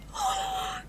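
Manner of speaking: high breathless gasp of delight. Real, not acted. Player thrilled by the possum's name, gasping.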